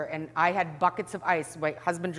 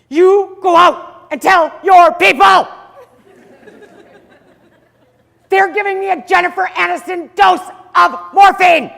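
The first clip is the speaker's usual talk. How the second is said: much higher, louder, slow furious shout; desperate yelling; 12 semitones higher, 13 dB louder than usual. Retold or imitated. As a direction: yell at the trainee through the pain. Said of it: "I'm screaming at him".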